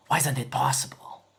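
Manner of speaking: ordinary talk, nothing marked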